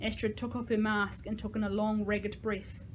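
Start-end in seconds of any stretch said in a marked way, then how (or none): none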